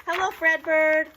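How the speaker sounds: high, loud cheer of a hello; cheering crew. Acted. Grounds crew cheers to Fredbird, loud happy greeting. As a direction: shout the greeting with joy. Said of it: "they cheered and said".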